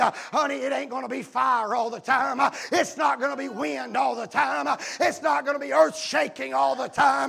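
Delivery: sermon swelling to a high, stretched final shout; preaching building to shout. Acted. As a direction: build relentlessly into a full roar.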